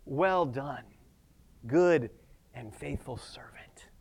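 hushed, low praise fading to a whisper; Jesus Christ. Acted. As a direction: speak softly and slowly, with pauses, in tender approval. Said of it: "Jesus Christ saying".

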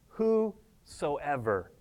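whosoever slowed right down and spaced out; stress on whosoever. Shown as a demonstration. Preacher stressing whosoever for the congregation, slow emphasis. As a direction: dwell on whosoever, softly and deliberately. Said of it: "I emphasize the word".

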